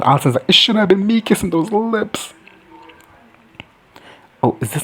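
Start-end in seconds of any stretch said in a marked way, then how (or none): 0.5-2.3 high put-on lamenting voice; mock jealous lament. Allison jealous over the kiss, mock lamenting. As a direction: wail it like a jilted lover, hammed up.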